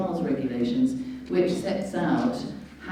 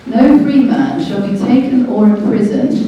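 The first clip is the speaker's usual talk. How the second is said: reading the charter out louder, each word weighted; emphatic reading; 6 semitones higher, 9 dB louder than usual. Shown as a demonstration. Reading Magna Carta aloud to viewers, emphatic and louder. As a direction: proclaim it like a law read aloud. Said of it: "I shall read it out to you".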